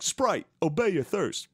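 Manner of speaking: high, slow announcer slogan; mock ad slogan. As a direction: drag out the tagline like a bad ad. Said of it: "it's like".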